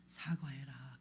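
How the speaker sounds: breathy hushed command; soft inner voice whispering. Retold or imitated. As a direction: whisper it like a voice heard in prayer. Holy Spirit speaking in prayer.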